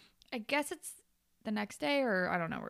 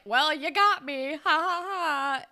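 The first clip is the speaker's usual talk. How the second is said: loud, higher, slowed fake laugh; joking admission; 6 semitones higher, 5 dB louder, slower than usual. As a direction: play along with an exaggerated ha-ha. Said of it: "Like, as a joke".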